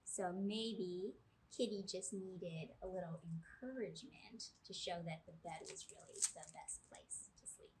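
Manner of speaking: usual speaking voice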